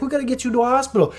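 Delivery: fast, high mock urgency; retold worried urging; urgent friend. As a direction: rush it out high-pitched. Friend urging hospital trip.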